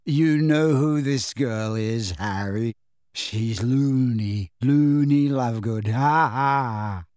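very slow, delirious teasing; giggling weakly. A narrator's reading. Dazed boy mocks a girl to his friend, giggly. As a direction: stretch each word giddily, ending in ha-has. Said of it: "still giggling weakly".

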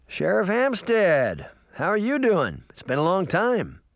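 hearty uncle. Acted. Uncle Jake hails the sheriff, hearty high greeting. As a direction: greet an old acquaintance with a lifted voice.

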